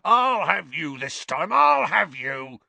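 high shout, slowing and drawn out; enraged threat. A narrator's reading. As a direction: bellow a vengeful threat, stretching the end. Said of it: "in a transport of rage".

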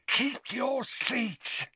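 soft, slow, high warning. A narrator's reading. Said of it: "said his father warningly".

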